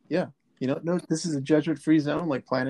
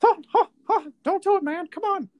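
very high squeaky cartoon mouse voice; Mickey Mouse voice. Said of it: "Jeremiah Sand line readings as Mickey Mouse".

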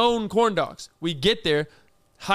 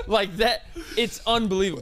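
ordinary talk

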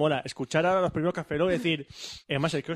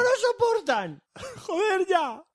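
mock rage shout; slower than usual. Mock rage again, laughing.

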